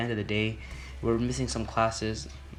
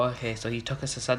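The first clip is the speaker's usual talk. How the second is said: usual speaking voice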